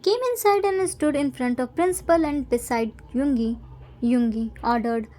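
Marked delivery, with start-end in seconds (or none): none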